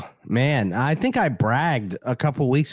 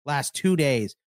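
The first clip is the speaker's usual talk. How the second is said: ordinary talk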